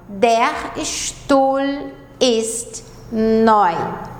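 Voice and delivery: Der Stuhl ist neu, drawn out very slowly; slow model sentence. Shown as a demonstration. Teacher modelling German for beginners, very slow. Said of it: "O E, U em alemão é oi".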